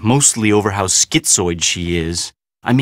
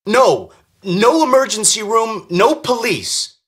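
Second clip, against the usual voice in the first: high-pitched, emphatic refusal; alarmed insistence; 10 semitones higher than usual. Acted. Ruling out emergency room and police, alarmed insistence. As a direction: forbid it firmly, voice pitched up.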